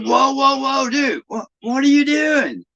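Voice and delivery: high-pitched mock alarm, last words stretched; retold alarmed protest. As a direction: yelp in disbelief, re-enacting the panic. Retells alarm at rolled flammable barrels.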